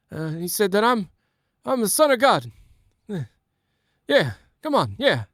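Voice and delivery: high rambling Biden voice; Biden impression. Same son of God joke redone as Biden, high rambling voice. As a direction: wander through the story like Biden. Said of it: "in a Biden voice".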